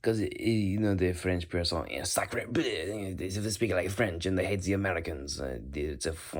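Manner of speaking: hammed-up French accent. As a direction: play a French person with a silly slurred accent. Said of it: "French is just fun".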